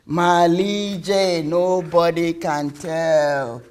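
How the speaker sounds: slow, drawn-out motherly pronouncement; stretched syllables. Acted. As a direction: draw out every syllable with weight. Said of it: "She stretched each word, each syllables".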